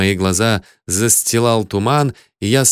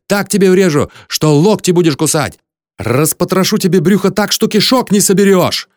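high, loud ranting threats; outraged, high-pitched tirade; furious yelling; 8 semitones higher, 4 dB louder than usual. A narrator's reading. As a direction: yell accusations in a rising rage. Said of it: "завопил я".

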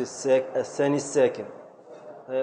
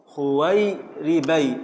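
"خويربي" drawn out slowly, syllable by syllable; slow scansion; much slower than usual. Shown as a demonstration. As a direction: stretch the word to fit its meter. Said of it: "يبقى على وزن". (تقطيع بطيء لكلمة "خويربي")

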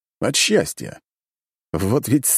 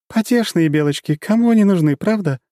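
rueful, wry and higher; sad wry chuckle; 12 semitones higher, 4 dB louder than usual. A narrator's reading. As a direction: smile sadly through it. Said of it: "грустно усмехнулась Тёмная".

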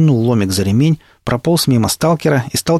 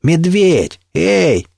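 higher, moderately loud call; shouting; 8 semitones higher, 3 dB louder, much slower than usual. A narrator's reading. Narrator shouts to Медведь behind the embankment, calling out. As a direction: call out to him across a distance. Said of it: "я прокричал".

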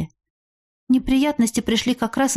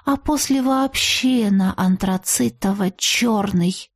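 ordinary delivery

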